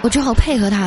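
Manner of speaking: ordinary delivery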